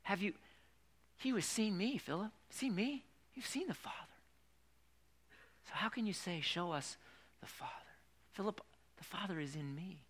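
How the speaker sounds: soft kindly Jesus; lightly voiced gentle Jesus; gentle, not accusing. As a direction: say it without any reproach. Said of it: "don't think Jesus used any kind of accusatory tone".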